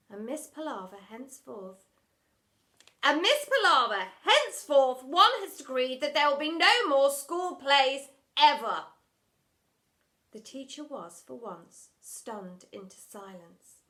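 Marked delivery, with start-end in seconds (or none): 3.0-8.9 loud formal pronouncement; loud decree. Acted. Headmistress banning all school plays, loud. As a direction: decree it with finality.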